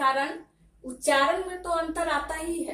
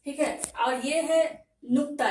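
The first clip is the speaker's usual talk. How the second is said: ordinary talk, nothing marked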